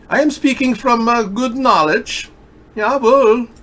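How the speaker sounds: very high-pitched mock German accent. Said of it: "I have a German accent".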